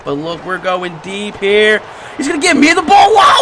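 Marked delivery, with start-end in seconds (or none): 2.2-3.4 loud, high, laughing hype; excited shout. Real, not acted. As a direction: yell with glee as the ball comes. Excited about deep pass.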